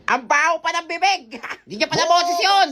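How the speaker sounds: normal talking voice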